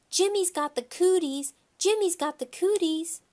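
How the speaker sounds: high, taunting playground chant; high-pitched schoolgirl jeer; yelling. Acted. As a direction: tease loudly in a high voice. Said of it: "she yelled".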